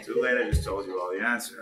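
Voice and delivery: ordinary talk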